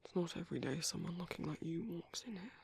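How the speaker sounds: hushed, slightly flirtatious whisper; soft whisper. Acted. Keeper admiring the handsome guest, hushed whisper. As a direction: let the compliment slip out quietly.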